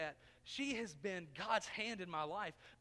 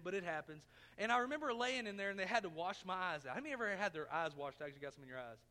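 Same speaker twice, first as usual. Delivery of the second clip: normal talking voice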